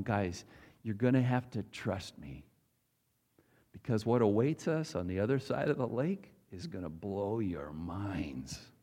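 gentle reassurance. Acted. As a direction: lean in and calm them. Jesus teaching disciples gently.

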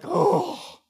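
a high, loud pained oh; anguished oh. Retold or imitated. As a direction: cringe out loud. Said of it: "I'm just like".

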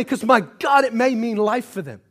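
loud, fervent preaching, rising in force; impassioned preaching. Acted. Preacher urging his church, fervent and loud. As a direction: preach it hard and loud to the room.

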